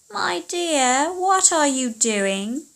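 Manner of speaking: high, sweet-sounding witch calling out; sugary high witch call, slow on the question. Acted. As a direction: call out like a crafty old witch. Witch calls out to daughter.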